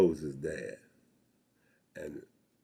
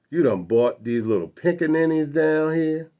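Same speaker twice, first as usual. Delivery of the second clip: loud, higher-pitched Southern cop imitation; contemptuous policeman; 8 semitones higher, 8 dB louder than usual. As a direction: throw it out as a demeaning challenge. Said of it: "because of the way he said it".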